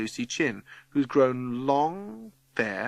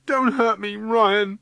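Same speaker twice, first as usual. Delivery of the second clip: high, louder tearful begging; sobbing plea; 10 semitones higher, 5 dB louder, slower than usual. A narrator's reading. Matthew cowering from Ryan's anger, sobbing. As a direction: plead as if through sobs. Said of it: "sobs Matthew".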